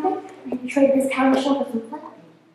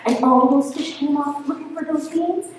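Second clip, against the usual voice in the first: plain reading voice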